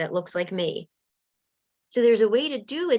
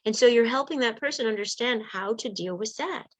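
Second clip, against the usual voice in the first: ordinary delivery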